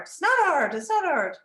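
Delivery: high, loud mock child protest; defiant child. Boy argues with teacher over task, imitated defiant protest. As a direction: contradict like an indignant small boy.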